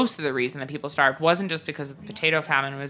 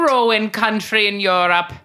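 slow, hammed-up bad Irish accent; terrible Irish accent; 5 semitones higher, 5 dB louder than usual. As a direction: repeat it slowly in a fake Irish lilt. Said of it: "speaking in this terrible Irish accent".

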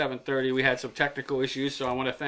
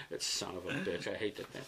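much softer, lower grumble; muttered curse; 8 semitones lower, 13 dB quieter than usual. Real, not acted. The host curses his insulin pump in a low grumble. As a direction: grumble it under your breath.

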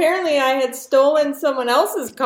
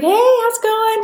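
high-pitched, chirpy imitation of a hello; much higher, breezy retold greeting; friendly greeting; 13 semitones higher, 4 dB louder than usual. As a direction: mimic a friend calling out a cheery hello.

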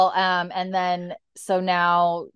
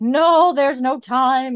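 loud, high, drawn-out refusal; hammed-up desperate wife in labour; mock urgent cry; 8 semitones higher, 9 dB louder, slower than usual. Wife grabs his arm as birth starts, urgent cry.

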